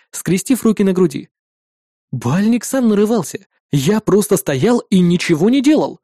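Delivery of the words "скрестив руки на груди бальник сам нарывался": ordinary delivery, nothing marked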